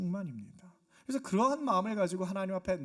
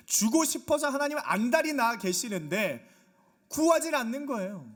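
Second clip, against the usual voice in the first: emphatic, raised sermon voice; impassioned preaching; 4 semitones higher, 4 dB louder than usual. Real, not acted. Preacher urging people to come and ask God, impassioned preaching. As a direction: press the promise on the congregation.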